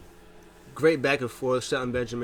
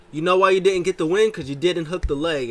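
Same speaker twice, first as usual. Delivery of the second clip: much louder, higher scolding; mock angry lecture; 6 semitones higher, 8 dB louder than usual. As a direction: chew him out like a coach. MVP lectures partner angrily.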